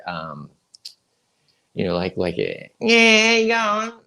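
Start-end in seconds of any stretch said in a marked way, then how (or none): 2.8-4.0 high, loud caricature of a local's greeting; imitated regional greeting. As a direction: call it out high, hamming up the local accent. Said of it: "hearing someone from, like".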